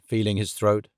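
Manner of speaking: ordinary delivery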